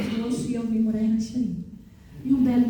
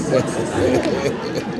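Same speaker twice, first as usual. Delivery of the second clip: "eu não era do Sossego" dropped much lower and slower; low put-on voice; 11 semitones lower, much slower than usual. Shown as a demonstration. Telling the audience where she lived, repeated in a low mock voice. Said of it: "eu não era do Sossego".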